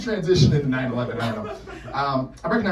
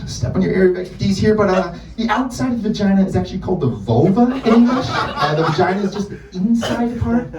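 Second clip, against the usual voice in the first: mansplaining self; 8 semitones higher, 3 dB louder than usual. Retold or imitated. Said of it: "And I go".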